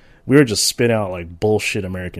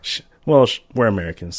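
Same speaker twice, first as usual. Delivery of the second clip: ordinary talk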